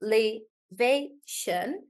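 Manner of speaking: elevation slowly split into four beats; syllable by syllable. Shown as a demonstration. Teacher breaking down a longer word, slow syllabled elevation. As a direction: spell out elevation beat by beat, with gaps.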